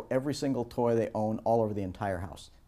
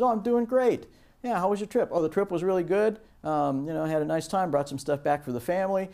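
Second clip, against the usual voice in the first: high friendly small talk; cheerful chat; 5 semitones higher, faster than usual. Acted. Colleagues catching up after a trip, high and cheerful. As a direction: chat away brightly and high.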